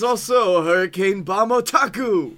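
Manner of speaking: lightly voiced Japanese anime rival; mock Japanese accent. Rival answering with the same name, mock Japanese accent. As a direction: play at a formal Japanese challenger. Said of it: "Japan in there".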